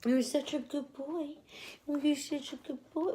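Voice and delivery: put-on high, slow doggy baby talk; imitating the voice people use with dogs. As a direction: coo it like praising a puppy. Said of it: "when you're talking to a dog".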